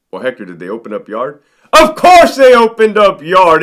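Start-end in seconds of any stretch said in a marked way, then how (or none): 1.7-3.6 louder, higher exasperated burst; exasperated outburst. Real, not acted. Officer venting about the yard reopening, loud and high. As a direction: throw it out in disbelief.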